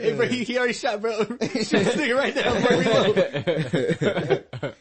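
high, loud laughing soldier voice; panicked soldier. Retold or imitated. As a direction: yell orders like a panicked musketeer. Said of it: "Like Jermaine said".

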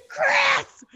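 high, loud cry for help; shouted name. Retold or imitated. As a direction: yell for your rescuer. Retold cry to brother-in-law.

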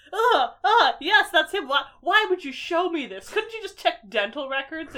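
high, loud horrified gasping voice; shocked girl. Retold or imitated. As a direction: play the girl recoiling in horror. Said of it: "and she's like".

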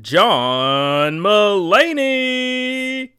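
long, drawn-out booming name call; ring announcer. Acted. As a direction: stretch the fighter's name way out, loud. Red corner name called.

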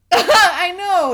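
high, loud, laughing agreement; real laughing. Real, not acted. Friend agreeing it might be fun, laughing loudly. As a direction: burst out in agreement through laughter. Both hosts cracking up.

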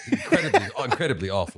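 laughing through the words. Real, not acted. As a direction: giggle out the agreement. Laughing agreement at S0's taste.

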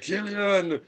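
slow, high Schwarzenegger one-liner; hammed-up action hero quip; Arnold impression. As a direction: drag out the pun like Arnold. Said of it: "and then he's like".

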